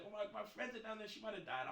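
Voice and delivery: mild mock-tearful voice; imitated crying. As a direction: lightly mimic her tearful worry. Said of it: "she was crying".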